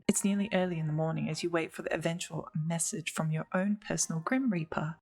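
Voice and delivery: ordinary delivery, nothing marked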